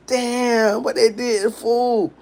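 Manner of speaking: a startled friend's concern; shocked. Retold or imitated.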